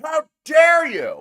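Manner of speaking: shouted mock outrage, high and loud. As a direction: yell it like an offended fan.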